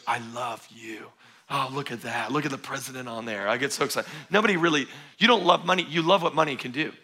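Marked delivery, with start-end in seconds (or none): 0.0-4.1 soft, low, doting coo to a banknote; hushed mock infatuation; mock swooning lover. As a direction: whisper sweet nothings to your money. Imagined money lover's swoon.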